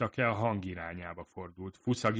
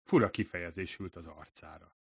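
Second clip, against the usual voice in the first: usual speaking voice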